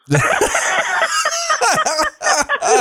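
big high burst of laughter; real laughter. Real, not acted. Drummer cracking up at his own story, laughing. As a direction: crack up at the punchline.

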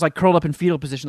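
normal talking voice

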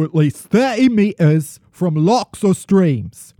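slightly wobbly mock-royal British; hammed-up British official declaration; attempted British accent. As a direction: proclaim it in an amateur British accent, like the Queen. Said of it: "Let me try to do an accent".

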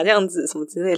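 ordinary delivery

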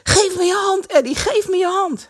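high, loud, breathless plea; panting. A narrator's reading. Kees on the ice pants to Eddy, high breathless call. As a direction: gasp it out while straining with all your strength. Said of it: "hijgde hij".

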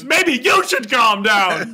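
very high, loud, slow comeback; hammed-up shrieked retort, words stretched out; snapping back. Podcaster as Ted throwing it back at them, overwrought. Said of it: "He goes".

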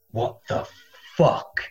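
whispered, slow disbelief; baffled whisper. Retold or imitated. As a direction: mutter it to your neighbour, stunned. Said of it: "comedians are just sat there going".

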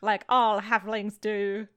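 hammed-up fake French accent, slow; a deliberately bad French accent for the cousin. GM voicing the French cousin in a bad French accent. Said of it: "I need to hear your French accent immediately".

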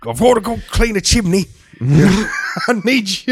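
high, slow pleading mother; mom voice. Retold or imitated. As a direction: beg like a needy mom. Imitating Ian's mom.

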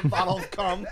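high, loud laughing repeat. Real, not acted. Friends repeating the pirate joke, laughing hard. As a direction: crack up while repeating it.

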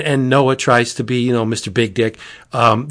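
ordinary talk, nothing marked

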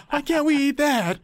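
very high whiny passenger; high silly voice. Retold or imitated. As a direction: squeak it like a cartoon. Joking about cruise passengers.

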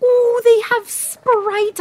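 high, slow, drawn-out thrill; delighted excitement. Acted. Orphan spotting a soda on the menu, delighted excitement. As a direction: stretch it out high with wonder.

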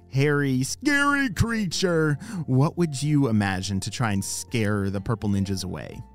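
ordinary delivery

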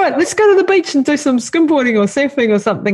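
high, keen rallying voice; rally him for a spontaneous outing; eager suggestion. Retold or imitated. Wife proposing a beach trip to her husband, eager and high. Re-enacts daily fight with husband.

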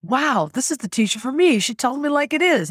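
excited realization. Retold or imitated. Student recalling the thrill of finding her teacher, excited.

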